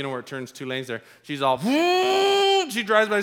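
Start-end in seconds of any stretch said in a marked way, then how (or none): 1.6-2.6 high gleeful cackle as she passes; gleeful laugh. Retold or imitated. Wife passing husband's car, high mocking laugh. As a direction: laugh at the slowpoke.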